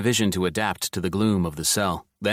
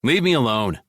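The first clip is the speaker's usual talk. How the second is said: shout; 4 semitones higher, 4 dB louder than usual. Acted. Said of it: "and shouted".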